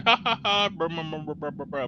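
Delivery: high cackle trailing into mumbled brr; imitated sleep laughing and mumbling. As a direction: mimic someone laughing and mumbling in their sleep. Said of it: "So you could hear, like, laughing".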